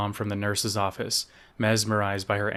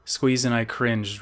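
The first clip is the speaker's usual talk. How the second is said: ordinary delivery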